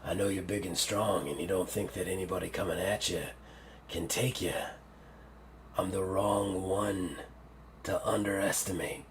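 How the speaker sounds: low, very soft villain warning; deep, hushed threat from a creature sure of itself; menacing octopus. Acted. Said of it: "He's like".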